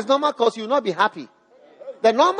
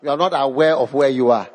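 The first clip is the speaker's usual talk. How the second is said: usual speaking voice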